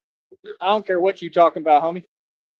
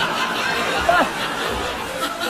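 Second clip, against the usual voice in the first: high wheezing laugh, almost silent; real laugh caught off guard; breathless laugh; 11 semitones higher, 4 dB quieter than usual. Real, not acted. Laughing at absurd claim.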